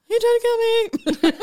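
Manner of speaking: very high, loud squeal of mock panic; mock-frightened daughter. Daughter lying to her angry dad, hammed-up high wail. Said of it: "I would have totally been the same thing".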